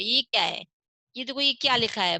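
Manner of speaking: ordinary delivery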